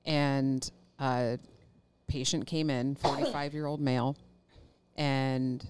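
ordinary delivery

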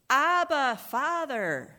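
loud, high anguished cry of Abba, Father; desperate loud cry of Abba, Father. Retold or imitated. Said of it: "he's crying out".

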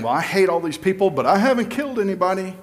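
high, fast mocking self-justification; mock their smug excuse; smug self-righteous.

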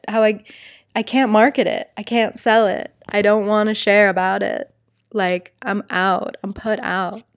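ordinary talk

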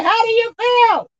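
a preacher shouting to his flock; pastor calling out. Retold or imitated. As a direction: rouse the congregation with a question. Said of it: "My pastor said".

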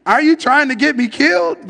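high, loud demand, alarmed. A narrator's reading. Medium confronting the disguised Saul, loud demanding. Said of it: "the woman demanded".

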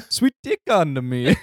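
mock Italian pizza man accent; hammed-up Italian pizza man voice. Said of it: "just wanna say it like a pizza man".

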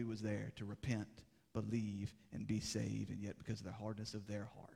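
low, soft, grave sermon voice; quiet, weighty pulpit reflection; solemn preaching. Acted. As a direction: bring it right down, sober and hushed. Solemn, hushed preaching on rejection.

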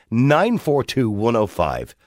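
ordinary delivery

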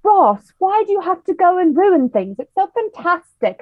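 high, loud mock British accent; hammed-up British English imitation; fake British accent. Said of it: "British accent".

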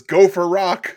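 hammy, loud phone greeting; punchy, mock-macho greeting; The Rock answering phone. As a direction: belt it out as a cocky star. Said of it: "He says".

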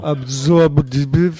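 "Absorbive" drawn out very slowly, rising at the end; mocking echo. Teasing the host's odd word, "Absorbive" slowly drawn out.

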